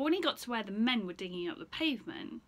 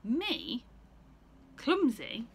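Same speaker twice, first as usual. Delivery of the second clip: indignant kangaroo; 4 semitones higher, 8 dB louder, much slower than usual. Acted.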